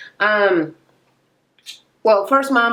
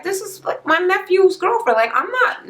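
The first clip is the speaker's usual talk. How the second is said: high, squeaky mock protest; weirded-out protest; 10 semitones higher than usual. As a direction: retell his awkward recoil in a squeaky mock voice. Tommy recoiling at weird situation.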